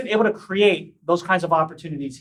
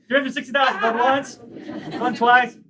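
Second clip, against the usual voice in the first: quick mock auction call; auctioneer; 4 semitones higher than usual. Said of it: "Felt like an auctioneer".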